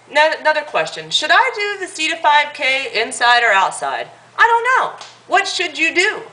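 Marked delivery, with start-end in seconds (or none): 4.4-6.2 mock asker. Mocking the indecisive question asker.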